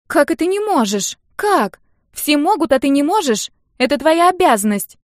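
put-on high, loud outraged girl; scandalised, voice leaping up; indignant. A narrator's reading. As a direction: protest in shrill-pitched indignation. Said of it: "возмущалась Нелли".

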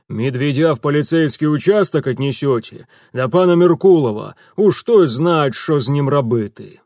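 loud barked orders; gruff commanding order. Acted. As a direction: order the soldiers about. Commands soldiers.

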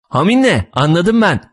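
put-on high-pitched childish announcement; excited little girl. A narrator's reading. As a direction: announce a proud discovery like a child. Little girl announces her discovery.

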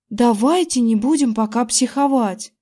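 plain reading voice

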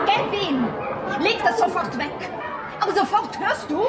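put-on high, sharp call down to the kids; calling out sternly. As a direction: scold the kids from a distance, half laughing. Ruft den Kindern unten zu. (streng nach unten zu den Kindern rufen, halb lachend)

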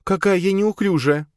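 high, loud, forced-cheerful woman; loud fake cheer. A narrator's reading. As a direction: cover it with a bright fake laugh. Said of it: "Она сказала это громко и натянула на лицо улыбку". (громко, с натянутой весёлостью)

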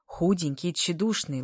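plain reading voice

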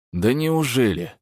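mock him with disbelief; sarcastic. A narrator's reading. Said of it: "язвительно осведомился Кричко".

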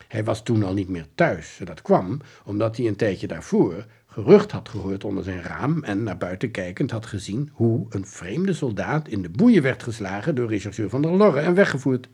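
usual speaking voice

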